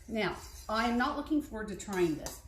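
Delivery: ordinary talk, nothing marked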